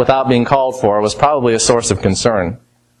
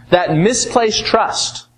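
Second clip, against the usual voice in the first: very slow, misplaced leaned on; misplaced hit firmly and drawn out; stress on misplaced; 5 semitones higher, much slower than usual. Acted. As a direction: let misplaced trust land word by word. Slowed emphatic repeat.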